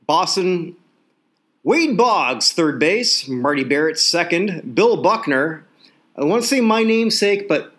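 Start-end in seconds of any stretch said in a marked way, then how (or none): none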